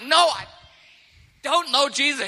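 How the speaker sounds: high, loud frantic yell; swearing at children. Retold or imitated. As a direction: lose it completely, shouting the denial. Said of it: "Peter's now swearing at children".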